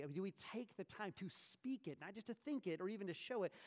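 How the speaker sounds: ordinary talk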